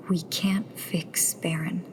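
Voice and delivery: slow soft whisper of loss; quiet grief for Baron; grieving whisper. Acted. Tessa whispers to Nero, grieving Baron.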